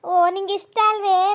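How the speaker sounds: loud, slow, overjoyed; loud gushing. Acted. Mother gushes over her son's choice, loud and slow. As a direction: gush over him loudly.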